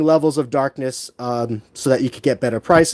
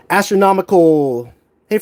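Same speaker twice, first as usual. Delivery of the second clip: usual speaking voice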